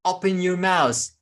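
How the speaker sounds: ordinary delivery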